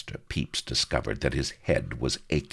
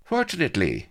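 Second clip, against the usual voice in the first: high, airy, offhand; careless aside; 14 semitones higher, 5 dB louder than usual. A narrator's reading. Blood adding to his tale for Avery, carelessly. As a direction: toss it off lightly but loud. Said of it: "he added carelessly".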